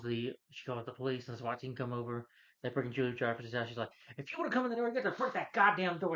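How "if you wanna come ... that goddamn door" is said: high, loud, hammed-up outburst; exasperated mock shouting; mock angry yell. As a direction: imitate an irate woman yelling, voice pitched way up. Retold wife yelling at SWAT team.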